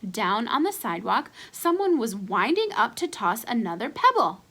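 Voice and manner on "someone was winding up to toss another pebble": plain reading voice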